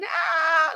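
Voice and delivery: short high imitated scream; hammed-up "aah" shriek; mock scream. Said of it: "just screaming".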